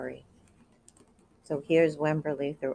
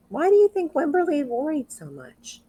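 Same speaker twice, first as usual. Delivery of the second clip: teacher question; 10 semitones higher than usual. Real, not acted. Teacher asks children.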